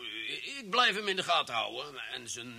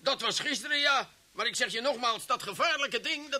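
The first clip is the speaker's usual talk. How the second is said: agitated insisting; 8 semitones higher than usual. Acted. Chief insists, rising agitation.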